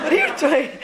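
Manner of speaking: put-on fifteen-year-old. Daughter walks in on parents playing backgammon, mock-appalled. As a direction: imitate a teenager catching her parents out. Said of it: "like a fifteen-year-old, she says".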